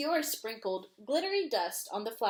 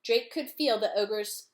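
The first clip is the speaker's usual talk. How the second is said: ordinary delivery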